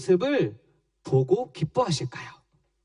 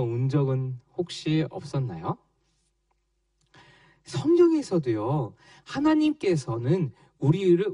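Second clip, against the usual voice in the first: ordinary talk, nothing marked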